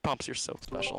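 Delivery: breathy held-back giggle; trying not to crack up; stifled giggles. Real, not acted. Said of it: "We do not need to hear your breathing".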